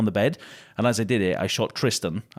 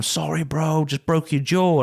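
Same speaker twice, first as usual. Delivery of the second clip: mocking attacker; 6 semitones higher, slower than usual. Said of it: "he walks off and he's like".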